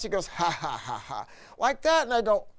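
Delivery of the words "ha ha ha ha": low, mock ha ha ha; imitated laugh. Retelling the owner's laugh at lunch, low mock chuckle. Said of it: "He laughs".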